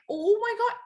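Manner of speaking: high-pitched mock panic; retold freak-out. Runner retelling her shock at the distance, freaking out. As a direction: exclaim in acted alarm, way up high. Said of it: "I literally started freaking out".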